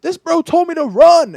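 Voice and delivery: high, loud mock outrage; retold indignant complaint. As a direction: protest loudly to teammates, hamming it up. Player complains to teammates, retold.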